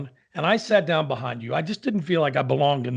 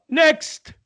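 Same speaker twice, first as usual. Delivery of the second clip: high, loud mock yell; sudden shouted cut-off; yelled dismissal; 11 semitones higher, 5 dB louder than usual. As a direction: imitate a maestro yelling for the next singer. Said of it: "he yells out".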